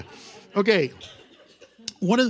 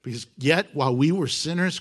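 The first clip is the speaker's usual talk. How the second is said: ordinary delivery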